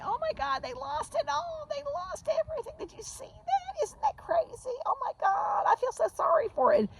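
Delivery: very high, squealy gossiping voice; mock a toxic gossip feigning pity; fake-sympathy gossip. Toxic gossip to friends about her downfall, high fake-pity voice. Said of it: "go run their mouths to all their toxic friends and say".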